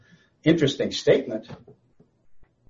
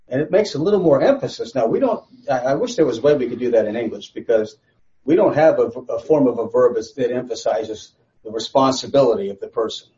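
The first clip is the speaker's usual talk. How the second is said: normal talking voice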